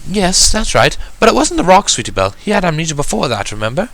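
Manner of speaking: a poised unicorn gently reminding her sister; Rarity's voice. Acted.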